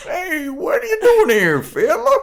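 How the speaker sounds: cackling grizzled gold digger; old prospector voice. Retold or imitated. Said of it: "an old prospector voice".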